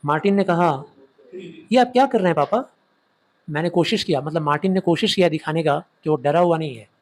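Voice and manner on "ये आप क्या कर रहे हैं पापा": put-on higher-pitched, puzzled child's question; alarmed son asking. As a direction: question dad in rising alarm. Alarmed son questions father in story. (घबराए बेटे का हैरान सवाल)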